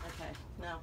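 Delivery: ordinary talk, nothing marked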